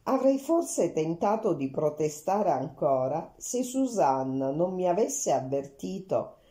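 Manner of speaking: ordinary delivery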